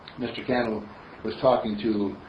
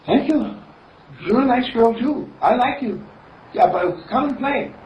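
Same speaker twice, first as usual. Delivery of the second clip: put-on high, louder happy child; pleased little girl; 11 semitones higher, 5 dB louder than usual. Penelope thanks the little girl, happy and high. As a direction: gush thanks like a happy kid. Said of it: "And she says".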